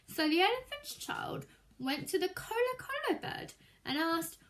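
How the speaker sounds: ordinary delivery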